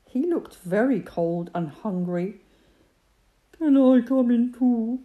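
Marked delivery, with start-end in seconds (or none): 3.5-5.0 high, loud fox voice. A narrator's reading. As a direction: voice the hungry fox. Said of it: "he asked".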